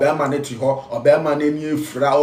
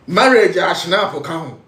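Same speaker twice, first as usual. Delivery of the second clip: mocking shout; 4 semitones higher, 6 dB louder, slower than usual. Real, not acted. Mocking schnapps-and-cigarette custom.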